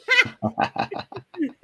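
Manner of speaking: high, loud cackling laugh; burst of laughter. Real, not acted. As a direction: crack up at the joke. Laughs at spirit's smoking joke.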